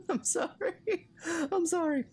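high giggling apology; laughing apology. Real, not acted. Gamer breaks up laughing while reading the journal. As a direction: apologise through helpless laughter.

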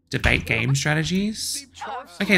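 ordinary talk, nothing marked

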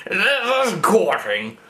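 slow, drawn-out mock-gallant voice; put-on courting voice. Mocking the ad, slow put-on courtly voice. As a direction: stretch it out like an old-fashioned suitor. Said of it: "I'll try and keep it up".

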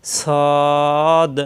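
"সদ" with a heavy, long-held vowel; articulation demo. Shown as a demonstration. Teacher shows where tongue meets teeth, drawn-out "সদ". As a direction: draw out "সদ" on one steady note. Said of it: "নিচের দাঁতের আগায় লাগবে".

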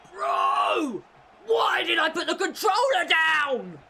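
high, loud self-directed yell; frustrated shout. Real, not acted. Gamer furious with himself for dropping the controller. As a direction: shout at yourself for a stupid mistake. Raging at his own mistake.

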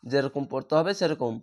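ordinary talk

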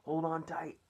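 high, loud warning call; excited shout. A narrator's reading. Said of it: "shouted the old green grasshopper".

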